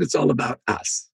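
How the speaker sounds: usual speaking voice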